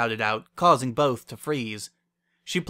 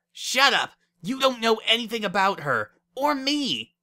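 loud, high-pitched defensive yell; angry outburst; 11 semitones higher, 4 dB louder than usual. A narrator's reading. Lyra defending her lover to Wendy, angry outburst. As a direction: lash out in a high, raised voice.

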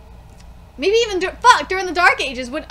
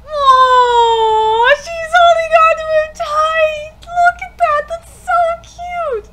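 gushing coo; 10 semitones higher, 8 dB louder, slower than usual. Real, not acted. Gushing over anime couple.

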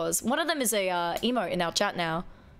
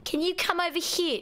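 higher, lightly voiced customer calling staff; a touch of a customer summoning a clerk; mock shopper calling out; retail customer call; 7 semitones higher than usual. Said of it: "reminding me of retail days".

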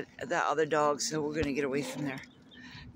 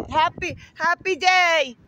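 high, loud and slow cheer; happy sing-song; 12 semitones higher, 11 dB louder, slower than usual. Real, not acted. Calling happy wishes to a friend, high and slow.

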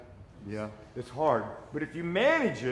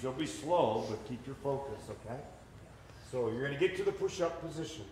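normal talking voice

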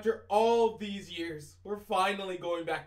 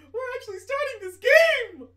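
much higher and louder thrilled yell; excited shout; 11 dB louder than usual. Real, not acted. Streamer shouting to viewers that the game is starting, high and loud. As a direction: shout it the second time with full joy.

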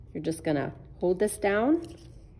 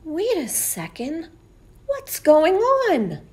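high, slow puzzled alarm; startled character; 10 semitones higher, 8 dB louder, slower than usual. Acted. Knight notices something odd, high and alarmed. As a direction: sound suddenly worried. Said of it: "narrator knight alarmed".

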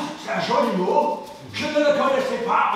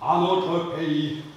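low, slow, fading toast; wistful toast; 7 semitones lower, 6 dB quieter, slower than usual. Acted. Man toasting his native Switzerland, wistful. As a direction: sink lower and softer, lingering on home.

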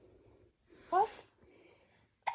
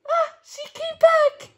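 loud, high, laughing joy; delighted; 11 semitones higher, 13 dB louder than usual. Acted. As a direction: burst out happy with a laugh. Happy ending of the story.

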